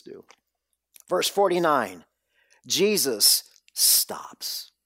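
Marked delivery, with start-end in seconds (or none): 2.7-4.7 whispered, drawn out slowly; hushed and very slow. Real, not acted. Preacher slowing to a whisper at Jesus stopping. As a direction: let it land in a hush.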